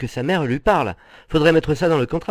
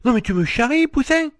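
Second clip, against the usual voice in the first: high-pitched, indignant comeback; affronted spouse, high-voiced; offended protest; 8 semitones higher than usual. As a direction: take offence, pitch leaping up. Husband offended at being called old.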